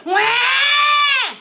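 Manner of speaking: shrill screaming infant cry; loud baby wail. Retold or imitated. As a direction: belt out a fake baby's wail. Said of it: "Instantly, the fake baby said".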